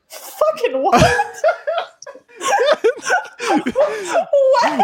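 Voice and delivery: high laughing what; laughing disbelief. Real, not acted. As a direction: cackle out the disbelief.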